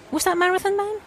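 ordinary talk, nothing marked

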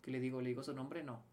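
low, soft, repetitive muttering to oneself; hushed, low-pitched fretting over what to say; anxious inner monologue. Retold or imitated. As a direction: replay nervous thoughts under your breath. Rehearsing nervous inner thoughts.

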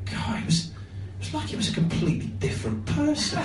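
unvoiced hushed whisper of an audience member; whispered audience remark. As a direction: whisper it like a punter behind the curtain. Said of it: "someone actually went".

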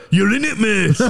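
high, cheeky, laughing through it; mock a smug local. Venue regular teasing the comic, laughing. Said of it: "They always go".

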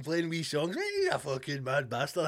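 ordinary talk, nothing marked

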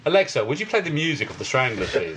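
polite posh English command, sinking at the end; posh Alexa request. Retold or imitated. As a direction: imitate someone being terribly posh to Alexa. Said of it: "speak so posh as him to Alexa".

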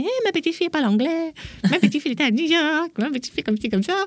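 high, fast boasting granny voice; imitating a proud grandma, high and quick; proud grandmother bragging. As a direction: brag about the granddaughter to the whole street.

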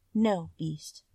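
a touch of trembling fear; understated, timid refusal, barely trembling. A narrator's reading. Beauty fearfully refuses his proposal, trembling slightly. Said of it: "she said, trembling".

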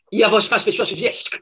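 mock Russian gibberish, lightly put on; half-hearted fake Russian audition line; bad Russian accent. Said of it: "Said some very bad Russian".

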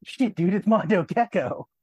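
high, loud, thrilled hiss of recognition; whispering excitedly to his girlfriend in the theater, high and loud; excited fanboy. Retold or imitated. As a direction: burst out like a kid spotting his hero. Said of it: "I would turn to my girlfriend and go".

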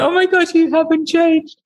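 high-pitched, laughter in the voice; amused exclamation. Retold or imitated. Woman retelling walking away from the singer, amused and laughing. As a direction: marvel at him, nearly giggling. Amused aside after retold meeting.

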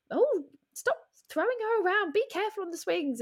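high, fretful mum calling to grandpa; a mum at the park fretting at grandpa, high and fussy; fussing mum. Retold or imitated. Mum calling across the park to grandpa, high and anxious. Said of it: "and I see the mum".

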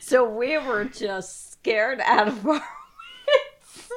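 amused retelling; laughing. Real, not acted. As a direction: laugh through the words. Laughing while recalling fright.

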